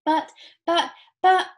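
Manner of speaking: high, loud spluttering; stammered shriek. A narrator's reading. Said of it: "shrieked Missus Salt".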